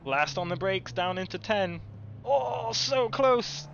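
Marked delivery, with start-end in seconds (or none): 2.2-3.7 excited. Real, not acted. Near overtake in race.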